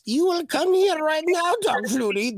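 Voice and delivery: high-pitched little alien master voice; Yoda voice. Acted. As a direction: pitch way up like a tiny wise alien. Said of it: "That's my Yoda voice".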